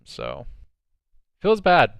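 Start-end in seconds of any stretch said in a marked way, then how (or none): 1.4-1.9 exaggerated lament. Real, not acted. Lamenting the loss as a fan.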